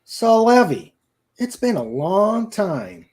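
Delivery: high, loud and slow, looking down on her; haughty. A narrator's reading. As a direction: talk down to her like a spoilt rich boy. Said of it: "He said haughtily".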